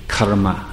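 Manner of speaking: "karma" with the special Sanskrit r sounded; Sanskrit pronunciation. Shown as a demonstration. Teacher giving the Sanskrit word used in the West, r sounded. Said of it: "making that special sound with the r".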